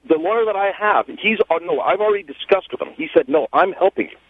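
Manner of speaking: ordinary talk, nothing marked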